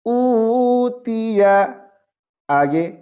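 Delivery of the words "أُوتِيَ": أُوتِيَ slow, higher, long و; tajweed pronunciation. Shown as a demonstration. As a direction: stretch the و in أُوتِيَ. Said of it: "کیسے پڑھیں گے اس لفظ کو؟".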